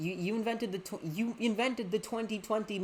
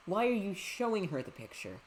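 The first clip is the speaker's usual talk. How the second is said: ordinary talk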